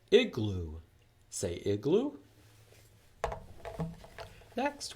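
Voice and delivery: ordinary delivery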